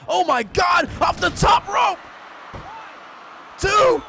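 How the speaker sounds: yelling in shock at the move; screaming commentator. Real, not acted. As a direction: shout the count like the crowd. Top-rope move, commentator screams.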